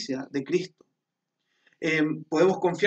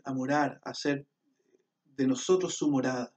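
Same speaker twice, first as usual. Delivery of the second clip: ordinary delivery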